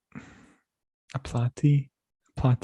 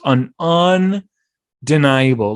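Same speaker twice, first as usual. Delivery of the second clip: slow and louder, each syllable leaned on; emphatic; 4 semitones higher, 7 dB louder, much slower than usual. Real, not acted. Host to listeners about Paulie Walnuts, emphatic and slow. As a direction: declare it beyond argument.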